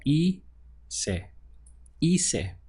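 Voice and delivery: "hice" said as EE-seh, silent h, the i as an ee; Spanish hice. Shown as a demonstration. Teacher modelling "hice" for students as EE-seh, silent h. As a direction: spell out "hice" slowly, EE-seh, no h sound. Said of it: "pronunciation starts here from the I".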